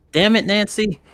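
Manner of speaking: lightly yelled curse, pitched well up; character's yell. Acted. Fang cursing at Nancy for the first time, mild high yell. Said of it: "He said, he yells".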